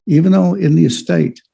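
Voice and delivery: ordinary talk, nothing marked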